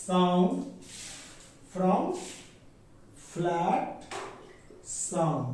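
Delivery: each word on its own with long pauses; teacher reading notes aloud slowly; slow dictation. Shown as a demonstration. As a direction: dictate to the class at writing speed.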